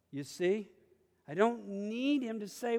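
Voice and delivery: usual speaking voice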